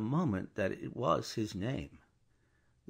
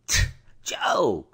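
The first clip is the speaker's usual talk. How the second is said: sharp, breathy warning hiss of a coachman; tense coachman's warning; warning call; 3 semitones lower, 7 dB louder than usual. A narrator's reading. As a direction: warn him off with a sharp call. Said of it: "cried the coachman in a warning voice".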